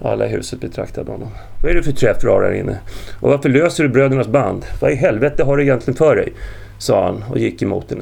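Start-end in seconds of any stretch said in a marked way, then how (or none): 1.6-6.3 loud, indignant demand; raised, heated scolding; angry confrontation. A narrator's reading. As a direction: fire off accusing questions. Angry man confronts her indoors.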